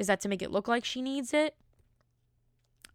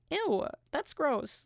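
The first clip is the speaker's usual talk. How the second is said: disgusted; 7 semitones higher, much slower than usual. Retold or imitated. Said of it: "she was like".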